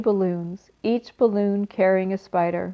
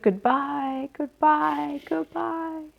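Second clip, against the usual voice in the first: weak far-off cries; 7 semitones higher, 3 dB quieter, much slower than usual. Acted. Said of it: "came weakly to Wilbur's ears".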